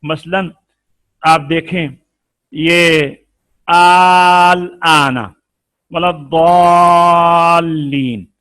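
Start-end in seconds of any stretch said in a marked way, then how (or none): 3.7-8.3 slow chanted tajweed with long madd on दॉलीन; drawn-out Quranic recitation. Shown as a demonstration. Teacher recites the verse to his students, slow and chanted. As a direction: recite आल आना वला दॉलीन stretching the vowels. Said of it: "ये एक लफ्ज है".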